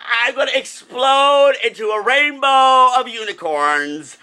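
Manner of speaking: slow, high and loud, bursting with glee; over-the-top excitement. Real, not acted. Fan bursting with joy over Pride Sunday, high and loud. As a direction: let it burst out slowly. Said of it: "I'm so excited".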